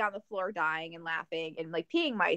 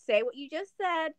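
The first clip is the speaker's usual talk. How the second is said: higher-pitched mock parent; pretend coaxing mom; 6 semitones higher than usual. Mom recording her cursing kid, playfully coaxing. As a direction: coax a child in a raised pitch. Voicing herself coaxing a child.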